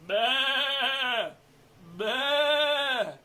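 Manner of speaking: loud, drawn-out baa; sheep bleat. Shown as a demonstration. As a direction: demonstrate a sheep's call. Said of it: "All sheep have to talk like this".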